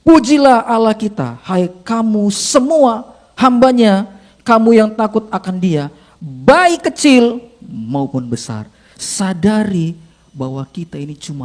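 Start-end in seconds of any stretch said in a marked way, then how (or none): 0.0-8.7 loud, very slow scripture proclamation; voice from the throne. Acted. Preacher reading the throne's call to all servants, loud slow proclamation. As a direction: proclaim it grandly, stretching each phrase. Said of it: "kedengaranlah suatu suara dari tahta itu".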